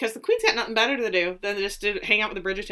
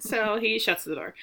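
usual speaking voice

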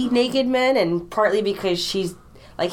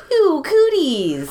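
slow, squealing disgust up high; grossed-out girl; 5 semitones higher, 4 dB louder, much slower than usual. Retold or imitated. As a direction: drag out a girly ew. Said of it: "Fourteen-year-old girls are like".

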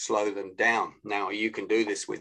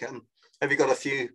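hurried, rapid-fire phone request; match a busy person's speed; fast pace; 2 semitones higher, much faster than usual. Shown as a demonstration. Coach plays a caller asking for time, fast. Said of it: "said it at a fast pace".

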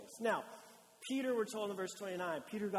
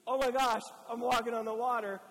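amazed; 3 semitones higher, 7 dB louder than usual. Acted. Pastor voicing Peter's amazed thought on the water, loud and thrilled. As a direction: shout it like you can't believe your feet.

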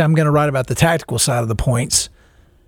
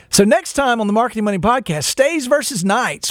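lightly announcer-style teaser, higher pitch; podcast teaser; 6 semitones higher than usual. Pretend. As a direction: tease the next episode like a promo. Mock teaser for next episode.

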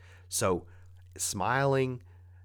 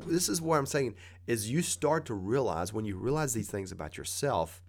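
ordinary talk

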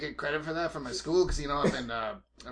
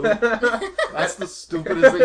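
laughing; 10 semitones higher, 13 dB louder, much slower than usual. Real, not acted. Mocking a friend's silly line.

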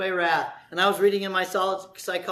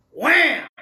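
loud wham; 7 semitones higher, 7 dB louder than usual. Acted. Sermon illustration of blow.

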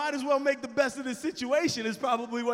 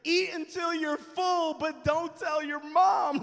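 excited dad; 6 semitones higher, 5 dB louder, slower than usual. Retold or imitated. Dad excited giving donuts.

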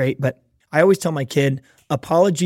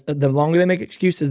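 ordinary delivery

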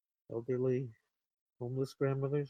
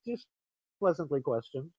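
ordinary delivery

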